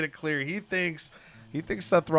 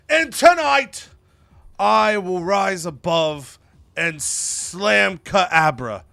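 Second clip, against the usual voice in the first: loud, slow theatrical declaiming; dramatic proclamation; 4 semitones higher, 5 dB louder, slower than usual. Acted. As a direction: declaim it grandly, stretching each word. Resumes dramatic speech after prompt.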